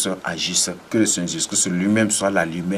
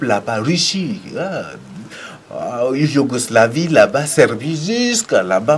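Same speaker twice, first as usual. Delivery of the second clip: raised, loud prophetic proclamation; impassioned preacher calling in far-off nations; fervent preaching; 4 semitones higher, 4 dB louder than usual. Real, not acted. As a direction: preach it out with mounting force, high and loud.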